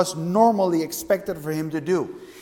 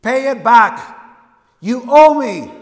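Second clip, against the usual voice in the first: stern, loud demand rising higher; 4 semitones higher, 8 dB louder, slower than usual. Retold or imitated. Master's slave demanding his money, stern and loud. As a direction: bark the demand at the debtor. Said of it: "with a strong, stern voice".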